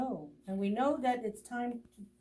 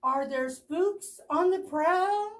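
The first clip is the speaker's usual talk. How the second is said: high, louder frightened bear voice; scared bear; 9 semitones higher, 5 dB louder than usual. Acted. Bear in the storm, frightened question. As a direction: ask it nervously as Bear. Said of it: "Bear mutters".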